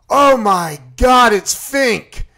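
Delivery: loud, high, drawn-out alarmed cry; retold panic. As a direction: re-enact a horrified discovery at full volume. Spots Fink outside, retold panic.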